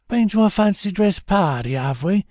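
high, mocking weaselly voice; sneering rat-faced thug. Rat-faced robber taunting Bill, sneering high voice. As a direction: sneer up at him like a sly little rat.

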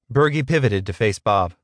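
usual speaking voice

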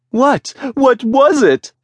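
dying to know what happened; breathless curiosity. A narrator's reading. Merchants desperate for the ending.

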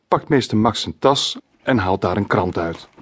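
ordinary delivery, nothing marked

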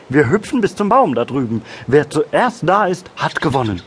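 high, eager frog voice; excited little frog. A narrator's reading. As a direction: propose a game with eager energy. Frog proposes a race. (aufgeregte, hohe Froschstimme)

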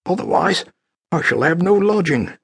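high, determined woman's voice; Annie's resolve. Acted. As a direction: talk herself into it. Annie's drunken inner resolve.

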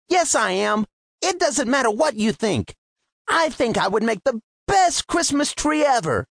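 a lightly voiced hopeful Timmy; defiant little tree. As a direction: answer back with small pride. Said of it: "Timmy straightened his trunk".

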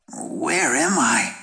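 put-on slow, sleepy wondering teen; groggy boy. A narrator's reading. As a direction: wake up confused and slow. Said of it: "he wondered".

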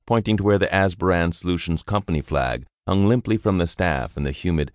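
plain reading voice

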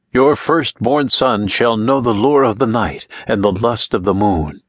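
solemn intoned curse; spellcaster's intoned curse; intoning. A narrator's reading. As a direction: intone it like a ritual pronouncement. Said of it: "Anne intoned".

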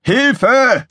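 high, loud summons; Gizmo calling out. A narrator's reading. Engineer summons ship's computer, urgent shout. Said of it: "rief Gizmo".

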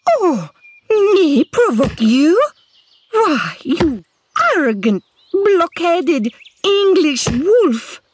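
high, slow indignant outrage; furious. A narrator's reading. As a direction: spit out the insults in fury. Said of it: "stamped her foot in fury".

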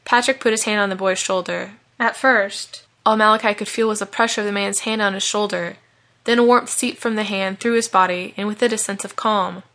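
ordinary delivery

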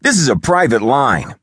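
high, irate complaint; indignant shout. A narrator's reading. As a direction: shout down an unwelcome caller. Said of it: "I shouted at my untimely caller".

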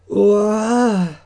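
sudden high yelp of fright; jolted awake with a yelp; startled cry. Acted. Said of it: "said Jim".

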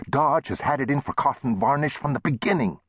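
high-pitched aggrieved insistence; demanding. A narrator's reading. Shopkeeper accusing the rival town, demanding. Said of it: "Chester demanded".

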